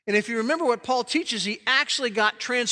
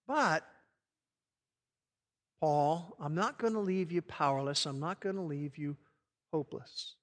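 soft, unhurried reply with long pauses; quiet, lower voice of God, acted; hushed divine answer; 3 semitones lower, 8 dB quieter than usual. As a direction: answer gently and firmly as God, sinking softer. Preacher voices God's gentle answer to Paul.

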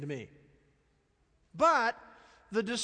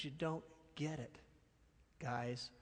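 soft, lower, earnest; gentle explaining Jesus; 6 semitones lower, 10 dB quieter, slower than usual. Retold or imitated. Jesus gently correcting the disciples, soft. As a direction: lean in and let it sink in. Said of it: "Jesus was saying".

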